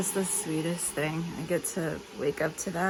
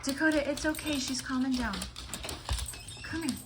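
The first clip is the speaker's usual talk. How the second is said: talking to a dog; 8 semitones higher than usual. Real, not acted. Calming dog.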